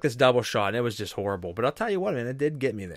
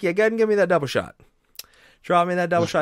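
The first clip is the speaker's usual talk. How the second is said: cocky loud order; 8 semitones higher, 6 dB louder, faster than usual. Retold or imitated. Retold bold drink order.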